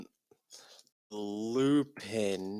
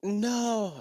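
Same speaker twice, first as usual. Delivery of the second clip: much higher, louder hammed-up no; mock scream; 13 semitones higher, 7 dB louder than usual. Ebony screaming at the news, hammed-up. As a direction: scream it like melodrama. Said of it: "I screamed".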